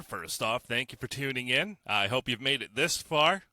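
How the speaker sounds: ordinary talk, nothing marked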